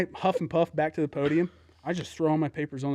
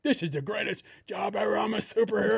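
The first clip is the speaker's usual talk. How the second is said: high, mock-heroic glee; mock elation; 6 semitones higher than usual. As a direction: gush like a proud superhero. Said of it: "little fucking carrot that's like".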